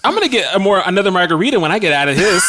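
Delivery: put-on high, quick girly voice; mock chatty girl. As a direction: imitate a girl chatting excitedly over drinks. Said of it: "I just can't imagine girls like".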